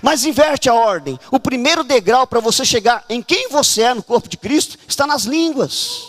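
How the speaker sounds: ordinary talk, nothing marked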